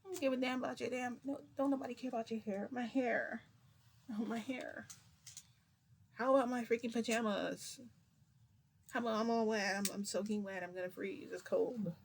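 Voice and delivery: high, quick hammed-up whining; mock whiny complaint. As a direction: parody someone soaking wet and fussing. Mocking drenched Fat Gum's complaints.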